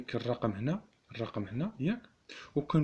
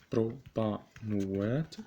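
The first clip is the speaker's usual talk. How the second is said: بروبانوات drawn out slowly; slow dictation; much slower than usual. Shown as a demonstration. Teacher dictating to students, بروبانوات said slowly.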